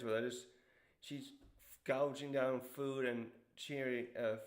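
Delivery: ordinary talk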